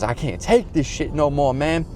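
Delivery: mock whiny complaint; imitating Wings. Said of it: "He goes".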